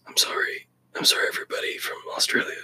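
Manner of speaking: whispered sheepish apology; embarrassed whisper. Real, not acted. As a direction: mutter an apology, mortified. Embarrassed apology after bad accent.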